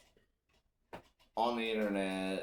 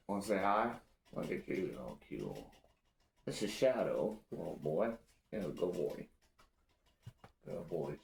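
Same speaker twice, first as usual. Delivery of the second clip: talking to dog; 2 semitones lower, 6 dB quieter than usual. Real, not acted. Talking to his dog Shadow.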